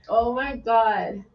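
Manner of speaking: usual speaking voice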